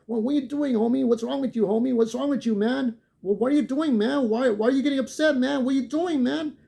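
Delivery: high, whiny mock-innocent voice; a mocking impression of the innocent-acting biker. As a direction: play dumb in a high, put-upon whine. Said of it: "he's trying to act like".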